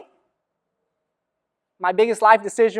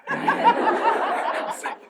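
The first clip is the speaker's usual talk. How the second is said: laughing; 12 semitones higher, 6 dB quieter, much slower than usual. Real, not acted. Laughing at his own memory.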